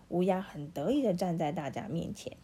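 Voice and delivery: usual speaking voice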